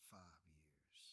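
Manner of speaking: barely whispered reveal. Real, not acted. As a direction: let it fall to a whisper. Revealing his short sentence.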